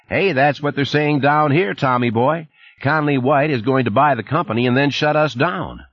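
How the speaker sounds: a chummy Texan supervisor on the phone; Texas twang. Acted. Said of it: "the familiar voice with the Texas twang".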